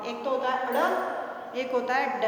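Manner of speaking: अड़ slow with a flapped tongue, then ड as a hard stop; flap versus stop. Shown as a demonstration. Teacher contrasting अड़ and ड for the class, slowly. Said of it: "अड़ और ड में फर्क होता है".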